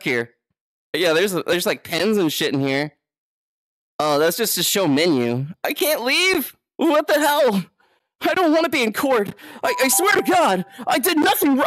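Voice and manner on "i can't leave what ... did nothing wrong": high, pleading playful panic; hammed-up distress; mock panicked protest. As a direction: plead innocence in a raised pitch. Mock protest at being in court.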